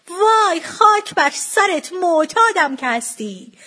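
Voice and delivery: high, loud, drawn-out dismay; scornful outburst. A narrator's reading. Mother deciding the seller is an addict, scornful outburst. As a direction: wail it with contempt, stretching the words.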